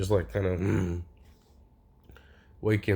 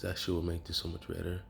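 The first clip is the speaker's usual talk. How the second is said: ordinary delivery, nothing marked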